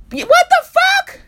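high, loud disbelieving shriek of what the fuck; stunned outrage. Real, not acted. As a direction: jump way up in pitch, loud and incredulous. Outraged at the tech's suggestion.

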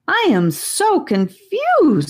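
put-on high, slow, puzzled young girl voice; a bewildered child drawing out each word; confused girl. Annika voiced in story.

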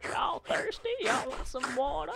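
put-on high, breathy old lady voice; grandma voice. Grandma asking the kids about water, high old voice. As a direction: sweetly offer them water like grandma.

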